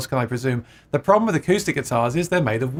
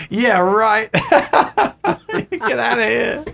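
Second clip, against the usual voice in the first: higher, louder, laughing it off as nonsense; scoffing disbelief; 9 semitones higher, 5 dB louder, slower than usual. Real, not acted. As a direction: brush off the comment with a laugh. Scoffing at a viewer's comment.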